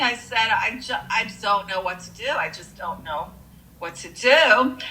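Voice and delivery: usual speaking voice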